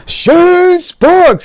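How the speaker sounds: high, loud shout of a name across the room; loud call. Retold or imitated. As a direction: yell the name out big. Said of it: "calling my name out so loud like that".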